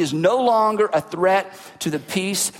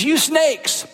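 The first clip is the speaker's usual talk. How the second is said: higher, scolding rant at the leaders; preacher voicing Jesus rebuking the religious leaders, raised and cutting; angry rant; 7 semitones higher, slower than usual. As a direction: hurl the insult up in pitch. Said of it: "you wouldn't let your kids talk like this".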